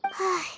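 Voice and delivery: a long tired sigh; soft exasperated exhale; weary sigh. Acted. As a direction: let the breath drop out, fed up. Worried companion, trouble already.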